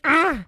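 sudden loud cry of pleasure; a loud gasping cry; sharp cry. Acted. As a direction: let out a startled loud moan.

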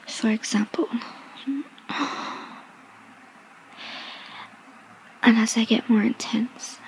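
breathy seductive ASMR whisper; sultry whisper. Acted. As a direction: whisper close to the mic, teasing.

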